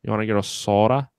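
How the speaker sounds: put-on a light Wisconsin accent with a round "soda"; a lightly done Wisconsin accent, drawing out "soda". Lightly mimicking how Wisconsin people say "soda", in a Wisconsin accent. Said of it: "like, soda".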